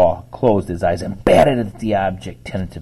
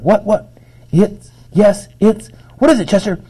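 flustered, much higher voice; startled; 11 semitones higher, 5 dB louder than usual. A narrator's reading. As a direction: stammer it out, caught off guard. Startled dog answers confusedly.

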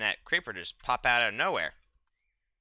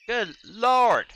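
much higher, louder yelp of surprise; startled shout; 12 semitones higher, 10 dB louder than usual. Real, not acted. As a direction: jump at the sudden creeper. Startled by a creeper.

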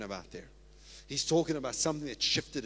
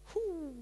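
whew; 14 semitones higher, 4 dB quieter than usual. Real, not acted. Preacher exhales mid-sermon.

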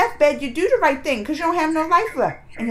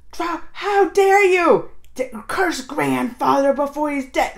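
mock angry Bhim; 4 semitones higher, 6 dB louder, slower than usual. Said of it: "always Bhim like".

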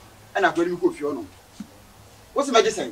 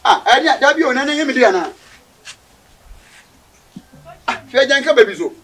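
laughing, pitch up and louder; 5 semitones higher, 6 dB louder than usual. Acted. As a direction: laugh it off in disbelief. Laughing at the bargaining.

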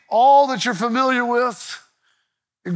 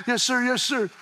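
meek reply; 6 dB quieter than usual. Retold or imitated. Kid answering coach, breathless.

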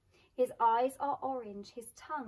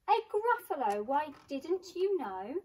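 high, scoffing "didn't you know"; mock astonished; 5 semitones higher, 5 dB louder than usual. Mouse scoffs at the fox.

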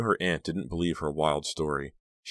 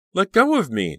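much higher, loud demand; 8 dB louder than usual. A narrator's reading. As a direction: yank free and order it. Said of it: "Danielle demanded".

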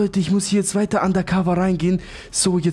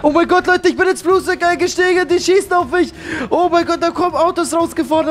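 high-pitched, raised-voice panic; frantic alarm; 7 semitones higher, 4 dB louder than usual. Real, not acted. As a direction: report in a flap while under fire. Under fire in the plane. (aufgeregt, hoch und panisch)

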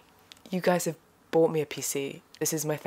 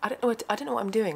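excited; 8 semitones higher, much faster than usual. Real, not acted. Excitedly opening new PC.

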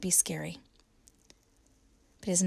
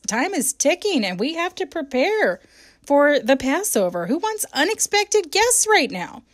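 higher and louder, rushed exasperation; mock hurried fluster; 8 semitones higher, 9 dB louder than usual. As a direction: sound like someone swamped before a feast. Preacher voicing busy disciples.

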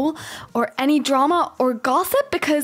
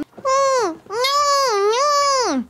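put-on high, squeezed toddler refusal; whining baby; 9 semitones higher, much slower than usual. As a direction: play a fussy baby protesting. Baby refuses being changed.

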